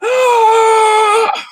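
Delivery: very high, loud held scream; full-throated hammed-up shriek; screaming in terror at the top of the voice. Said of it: "It's like".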